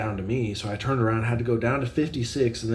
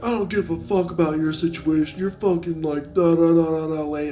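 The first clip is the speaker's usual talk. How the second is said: higher, louder mock rant of the pissed-off customer; angry customer; 6 semitones higher, 5 dB louder than usual. Delivery driver imitating the customer yelling at him. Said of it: "he's like".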